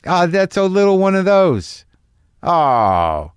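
a mock-giddy oh at the little bottles; little thrill. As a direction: gush like a kid finding miniatures. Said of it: "some excitement I get when I'm like".

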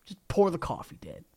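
soft, sugary mocking request; mock sweet coaxing. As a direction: coax Dad like a good boy, softly. Baki mocking his father sweetly.

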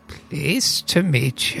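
meek little gnome, barely speaking up; timid gnome. Acted.

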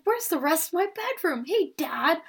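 put-on high, bewildered boy's voice wandering the set; confused kid. A confused boy calls out to his TV dad, pitched high. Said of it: "he walks around the set like".

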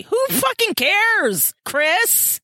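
disbelieving who-cares; exasperated. Real, not acted. Exasperated outburst at character.

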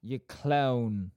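uncertain Bolton take on "clown"; attempted Bolton accent. Shown as a demonstration. Host echoing co-host, imperfect Bolton accent. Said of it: "I can't do that one".

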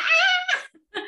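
high, loud mock shriek while laughing; play-scream at being carried off. Imagining being snatched by the Yeti, laughing mock scream. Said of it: "I was just like".

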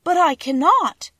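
put-on a girl stunned by the demand; surprised exclamation. A narrator's reading. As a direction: blurt it out in shock. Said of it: "exclaimed Dorothy, greatly surprised".

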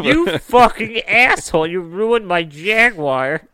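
high furious yell; angry car owner. Pretend. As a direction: rage at the ruined car. Imagined angry email from car owner.

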